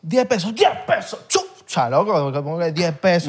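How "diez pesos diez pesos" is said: higher mock cabbie quoting a price; taxi driver. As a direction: imitate the cabbie naming his fare.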